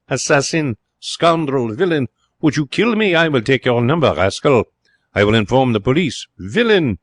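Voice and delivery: high, outraged acted tirade; angry shouting. A narrator's reading. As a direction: hurl accusations in theatrical fury. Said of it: "he shouted".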